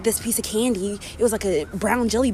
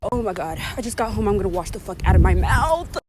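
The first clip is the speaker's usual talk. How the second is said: usual speaking voice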